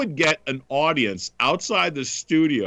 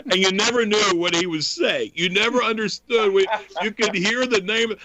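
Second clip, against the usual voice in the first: normal talking voice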